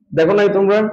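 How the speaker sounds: ordinary talk, nothing marked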